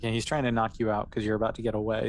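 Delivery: ordinary delivery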